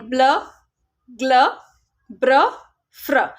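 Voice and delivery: ब्ल, ग्ल, ब्र, फ्र each blended as one tight sound. Shown as a demonstration. Teacher showing parents consonant blends, crisp and separate. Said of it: "beginning consonant blend है".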